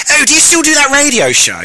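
put-on high-pitched posh English accent; posh man. Posh acquaintance asking about the radio show, high posh voice. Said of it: "doing an impression of a posh man".

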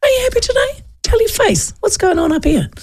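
high mocking catchphrase; teasing catchphrase. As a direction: needle someone who looks grumpy. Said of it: "one of my favourite sayings".

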